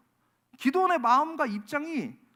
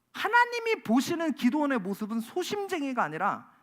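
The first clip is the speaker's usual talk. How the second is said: ordinary talk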